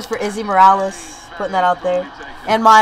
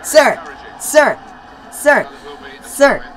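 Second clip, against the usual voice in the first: raised, insistent repeated call; 2 semitones higher, much slower than usual. Real, not acted. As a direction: keep calling out, each one separate. Repeatedly calling out during game.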